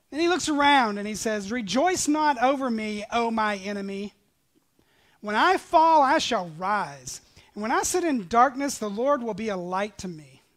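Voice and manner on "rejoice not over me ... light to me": defiant proclamation. Acted. Prophet answering mocking nations, loud and defiant. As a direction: hurl it at the enemy.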